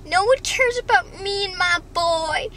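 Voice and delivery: high, loud whiny wail; hammed-up sob story; mock wailing mom. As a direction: wail it like a self-pitying mother. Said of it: "the mom's like".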